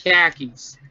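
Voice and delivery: car keys said as khakis, no r; Boston-style khakis for car keys; Boston accent. Shown as a demonstration. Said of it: "I'm from Boston".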